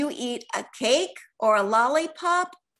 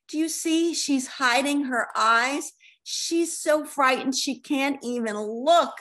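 plain reading voice

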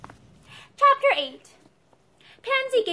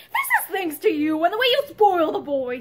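raised, accusing husband; louder, angry blame; 4 semitones higher, 5 dB louder than usual. A narrator's reading. Husband blaming his wife for spoiling the boy, angrily. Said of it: "he said angrily".

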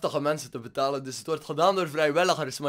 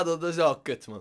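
usual speaking voice

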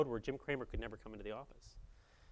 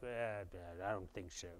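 low, soft imitation of an Australian accent; Rupert Murdoch; 6 semitones lower, 10 dB quieter than usual. Media boss turning down a sales pitch, imitated Australian accent. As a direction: mutter a reluctant refusal with an Australian accent. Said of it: "in his Australian accent".

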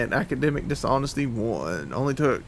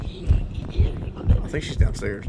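whispering while hiding; 4 semitones lower, much slower than usual. Real, not acted. Hiding from monster.

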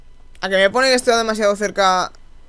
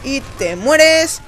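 slow, louder, stretched out; drawn-out dramatic; 4 semitones higher, 4 dB louder, much slower than usual. Acted. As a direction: draw it out like a verdict. Mock dramatic repeat.

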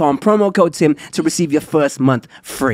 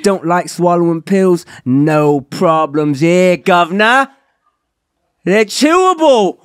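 hammed-up Cockney ad read; mock British accent, playful; 4 semitones higher, 5 dB louder than usual. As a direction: play a cheeky London geezer selling pills. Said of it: "Does the accent come with the Blue Chew?".

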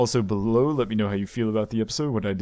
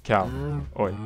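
ordinary talk

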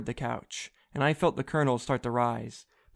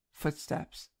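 whisper; 7 dB quieter than usual. Acted. Alarm at footsteps.

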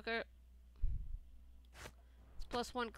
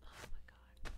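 whispering; 8 dB quieter than usual. Real, not acted. Whispered reaction.

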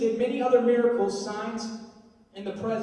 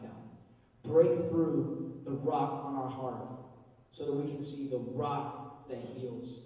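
lower, softer, fervent prayer; 8 semitones lower, 5 dB quieter than usual. Acted. Pastor praying over the youth group, hushed earnest prayer.

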